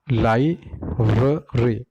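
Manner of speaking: लाइब्रेरी slowly split as lai, bruh, ree; syllable-by-syllable. Shown as a demonstration. Teacher showing three syllables, slow lai-bruh-ree. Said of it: "There are three different syllable".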